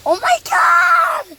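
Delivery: loud, high, drawn-out cry of amazement; excited shout. Real, not acted. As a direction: shout it out in wonder. Kid shouting in car wash.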